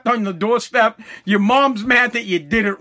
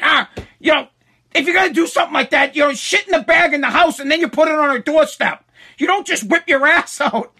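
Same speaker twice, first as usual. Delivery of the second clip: higher, quick mock mom lecture; retelling his mother's scolding, pitched up; imitating mom; 7 semitones higher, 3 dB louder than usual. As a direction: play his mom setting him straight. Mom scolds, voiced in story.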